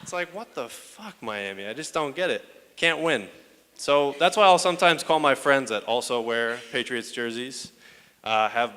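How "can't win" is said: ordinary talk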